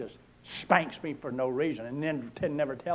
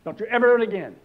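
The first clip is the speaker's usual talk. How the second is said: higher, louder scolding; scolding owner; 7 semitones higher, 9 dB louder, faster than usual. Acted. Store owner scolding the parrot, loud and high. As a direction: tell the bird off sharply.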